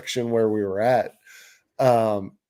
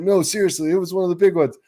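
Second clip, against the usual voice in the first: higher-pitched, earnest protest; retold insistence; 6 semitones higher, much faster than usual. Friend insisting his lost fish was big, earnestly pleading.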